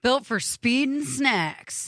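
high, slow movie trailer announcer. Pretend. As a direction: boom it out like a car ad announcer. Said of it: "I just wanted to read it in my announcer voice".

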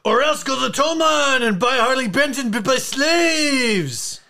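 high, put-on French voice for the quote; mock French accent. Reading a viewer's comment aloud in mock French accent. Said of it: "No more French for me".

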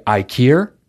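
"I care" said as Ikea with an r in it. Shown as a demonstration. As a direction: give "I care" an r like the word care. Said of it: "the R doesn't go there".

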